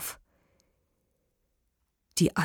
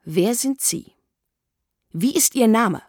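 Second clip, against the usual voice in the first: firm demand, higher and getting louder; increasingly stern; 4 semitones higher, 4 dB louder than usual. A narrator's reading. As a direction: interrogate him, ever more strictly. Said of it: "fragte er immer strenger". (immer strenger fragend)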